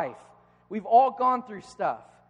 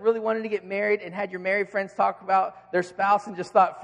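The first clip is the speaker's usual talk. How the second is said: ordinary talk, nothing marked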